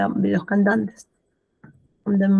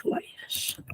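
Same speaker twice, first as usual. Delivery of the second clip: whisper; 14 dB quieter than usual. Real, not acted. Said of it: "whispered repeat".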